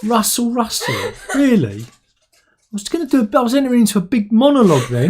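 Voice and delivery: high, laughing complaint; real laughter while protesting. Real, not acted. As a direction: laugh through the mock grievance.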